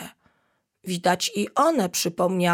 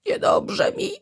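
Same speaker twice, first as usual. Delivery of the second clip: high, breathless choked-out words near tears; little monkey choking up at the breakfast table; choking out tearfully. Acted. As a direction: squeeze it out before bursting into tears. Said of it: "wykrztusiła małpka".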